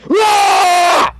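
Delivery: loud, high, sudden scream; angry yell. Shown as a demonstration. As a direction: let all the anger out in one yell. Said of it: "and then I'll go".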